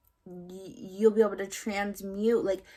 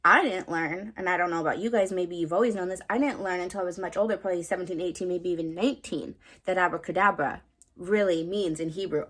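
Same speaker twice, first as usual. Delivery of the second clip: ordinary talk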